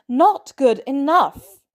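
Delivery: loud, slow, high cutting retort; sharp and angry. A narrator's reading. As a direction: cut him down, hard. Natalia cuts him off.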